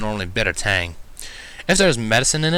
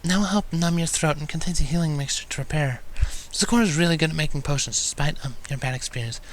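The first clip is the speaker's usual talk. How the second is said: soft, timid pleading mare; shy and quiet, lightly higher; timid Fluttershy; 5 semitones higher, 5 dB quieter than usual. A narrator's reading. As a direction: gently plead for her friend. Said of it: "she timidly requested".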